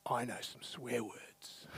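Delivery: put-on slow, hushed little-girl confession; a child quietly admitting a secret; child's secret. Daughter quoted at dinner table.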